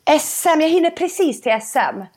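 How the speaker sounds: high, eager hopeful rush; overjoyed at the good news; excited. Retold or imitated. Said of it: "Så jag bara".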